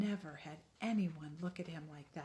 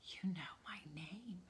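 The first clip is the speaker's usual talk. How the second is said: timid near-whisper; hushed wonder; small hushed question; 9 dB quieter than usual. Acted. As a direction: ask it softly in awe. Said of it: "the little Wemmick asked".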